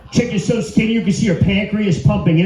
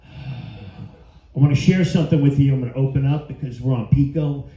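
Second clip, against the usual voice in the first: healer persona; 7 semitones lower than usual. Acted.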